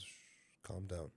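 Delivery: soft, low, hushing; soothing inner voice. Retold or imitated. Inner voice calming him before the blow, soft and low. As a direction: hush someone gently, voice dropped. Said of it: "this voice just takes over me and says".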